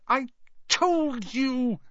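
very slow, high gasped reproach; gasping. A narrator's reading. Hermione with a stitch in her chest, gasping. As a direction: drag it out between gasps. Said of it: "Hermione gasped, clutching at the stitch".

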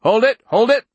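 high, loud yelled command; yelling. A narrator's reading. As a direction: yell at them to halt. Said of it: "one of them yelled".

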